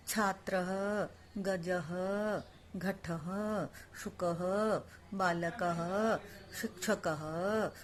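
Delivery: each Sanskrit word drawn out slowly for repeating; slow recitation. Shown as a demonstration. Teacher leading the class in a slow, repeat-after-me recitation. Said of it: "मैं बोलती हूँ पहले".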